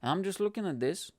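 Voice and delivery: ordinary talk, nothing marked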